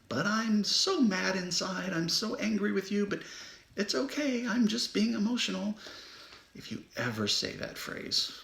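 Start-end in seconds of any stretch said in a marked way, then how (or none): 0.1-5.8 mock someone smiling through their rage; imitating someone hiding anger. Mocking people who smile while mad inside, high sing-song. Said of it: "and smiling all the time?".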